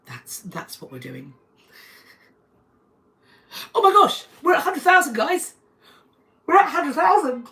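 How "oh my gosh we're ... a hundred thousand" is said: much higher and louder, squealing with joy; thrilled squeal at hitting a hundred thousand; real excited squeal. Real, not acted. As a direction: shriek the milestone news. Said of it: "I'm really excited".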